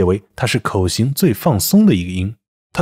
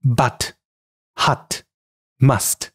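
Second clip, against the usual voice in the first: British English demo of but, hut, must; British pronunciation; 3 dB quieter, much slower than usual. Shown as a demonstration. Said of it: "英式英语".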